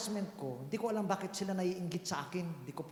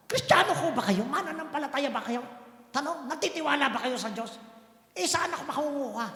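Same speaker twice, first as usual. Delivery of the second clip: challenging questions; 6 semitones higher than usual. Real, not acted. Preacher challenging the congregation.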